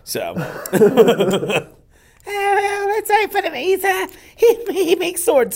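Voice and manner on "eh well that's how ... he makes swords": put-on high old guy voice; Adam Sandler style old man; Tarantino impression. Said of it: "my Tarantino impression".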